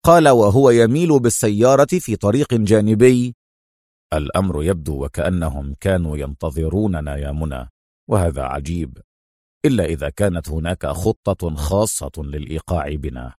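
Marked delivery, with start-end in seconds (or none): 4.1-13.3 deep, soft, pensive hero voice; calm, puzzled hero. A narrator's reading. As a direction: reason it out quietly, voice dropped low. Calm hero reasons with partner. (بطل هادئ متأمل بصوت منخفض)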